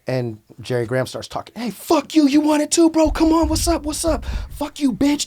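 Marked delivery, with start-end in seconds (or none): 1.6-5.3 taunting bully. Retold or imitated. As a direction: imitate a tough guy picking a fight. Retold bully taunting him.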